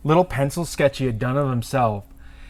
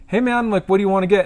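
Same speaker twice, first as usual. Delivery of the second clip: higher-pitched casual question; retold customer greeting; 6 semitones higher, faster than usual. Tattooist walking out to customer, higher-pitched greeting. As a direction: greet him with pitch lifted.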